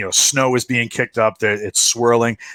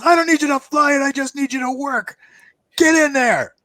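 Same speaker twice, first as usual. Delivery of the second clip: soldier voice. Acted. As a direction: push him toward the seat. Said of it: "He goes".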